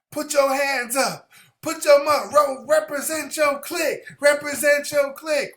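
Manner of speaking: high, loud rap-crowd chant; rally the crowd like a hype man; urban hype man. Acted. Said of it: "in a more urban sense".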